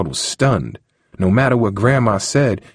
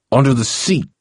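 growled; 6 semitones higher than usual. Acted. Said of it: "he growled".

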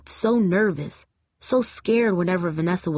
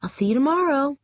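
high, sweet-sounding veiled threat; sugary threat; 8 semitones higher than usual. A narrator's reading. Vanessa parting from Jamie, sugary veiled threat. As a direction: sing it sweetly with a warning underneath. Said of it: "could hear the threat in her voice".

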